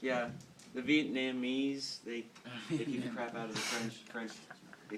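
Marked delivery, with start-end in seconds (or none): 0.7-2.0 "Vietnamese" drawn out slowly, each syllable stretched; funny way of saying it. Real, not acted. Student presenting history to class, drawls "Vietnamese" slowly. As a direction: say "Vietnamese" slow and deliberate, leaning on it. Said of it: "I like the way he says Vietnamese".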